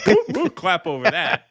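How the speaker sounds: laughing, hammed-up woo woo like clapping seals; mock seal cheers. Mocking the press as seals cheering Biden, laughing woo woo. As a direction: bark out a giddy seal cheer through a laugh.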